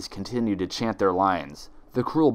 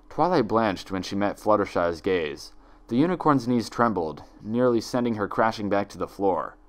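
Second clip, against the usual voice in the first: usual speaking voice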